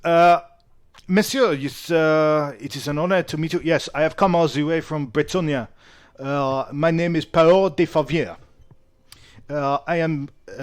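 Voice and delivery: put-on halting, formal French-accented speech; French-accented knight. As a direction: introduce yourself as a French knight. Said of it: "He's like".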